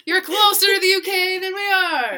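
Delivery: loud, high-pitched mock plea; hammed-up yell for help; calling out. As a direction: call out in playful exasperation, high and loud. Mock plea called to Scottish listener.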